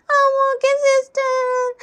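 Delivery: high, very slow whine; tearful child. Acted. Crying pupil agreeing with nurse, slow whine. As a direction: agree in a drawn-out kid voice.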